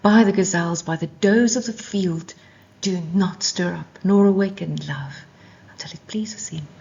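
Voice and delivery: solemn read-aloud of the Song of Songs; scripture reading. Acted. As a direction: proclaim the verse, dropping to a whisper at the end. Reads the scripture passage aloud.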